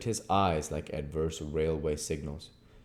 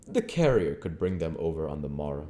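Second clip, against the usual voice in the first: usual speaking voice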